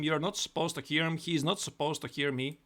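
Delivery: ordinary talk, nothing marked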